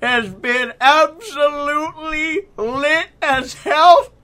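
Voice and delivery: high, loud, slow wrestling promo; Ric Flair impression. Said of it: "the nature boy, Ric Flair".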